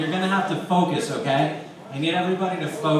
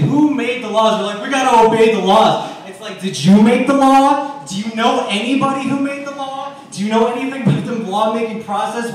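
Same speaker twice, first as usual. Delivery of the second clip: loud, rising preacher rant; fired-up sermon voice; preaching; 3 semitones higher, 4 dB louder than usual. Acted. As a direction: preach it at the crowd. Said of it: "I start preaching sometimes".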